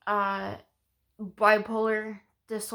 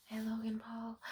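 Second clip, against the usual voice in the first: whisper; 14 dB quieter than usual. Real, not acted. Whispered shout-out to camera.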